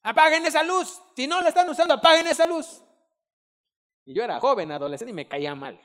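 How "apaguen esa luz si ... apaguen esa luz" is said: higher, louder mock yell from father; imitating dad yelling. Retelling how his father yelled at the kids, mock yell. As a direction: bark it like an annoyed dad. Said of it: "nos gritara".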